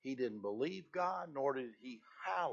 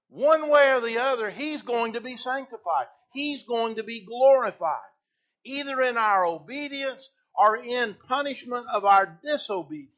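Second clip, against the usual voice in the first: louder, higher, emphatic sermon delivery; full-force preaching; 9 semitones higher, 12 dB louder than usual. Acted. Preacher driving home reverence for God, fervent. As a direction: preach it out to the congregation.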